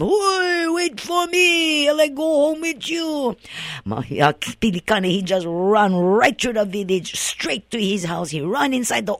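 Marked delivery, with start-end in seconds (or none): 0.0-3.3 very high, slow, unearthly drawn-out wail; ghostly wail. Retold or imitated. The rolling head chasing Pilikana, very high eerie ghostly wail. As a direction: wail after him like a chasing spirit. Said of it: "the head coming after him".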